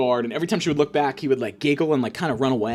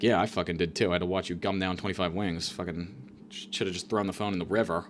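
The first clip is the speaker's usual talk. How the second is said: low muttered retort; 8 semitones lower, 9 dB quieter than usual. Retold or imitated. Retorting, much quieter.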